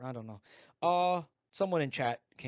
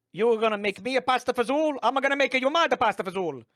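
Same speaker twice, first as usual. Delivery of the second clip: put-on high, loud Italian-American accent; hammy Italian-American host; 8 semitones higher, 8 dB louder, faster than usual. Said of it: "the Family Feud host say".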